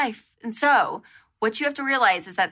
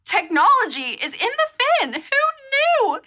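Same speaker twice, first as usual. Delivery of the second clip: mock amazement; 4 dB louder than usual. Mock amazement at hidden tech.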